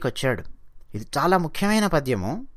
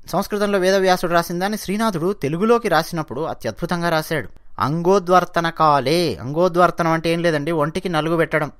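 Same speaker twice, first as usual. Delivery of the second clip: usual speaking voice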